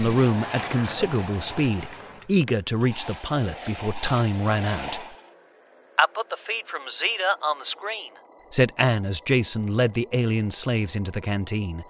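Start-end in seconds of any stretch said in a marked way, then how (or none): 6.0-8.1 Anne's voice. A narrator's reading. Anne speaking, voiced character.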